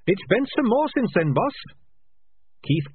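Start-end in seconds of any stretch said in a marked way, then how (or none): 0.1-1.5 high, small, oddly calm rat; a tiny chirpy voice, unbothered; little rat voice. A narrator's reading. Sardines trapped.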